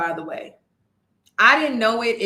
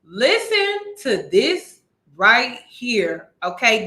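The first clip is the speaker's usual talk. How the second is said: slow, deliberate and louder, pressing each word; slow emphatic setup; 4 dB louder, slower than usual. Real, not acted. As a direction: make them lean in and listen. Reactor prepping viewers for shocking clip.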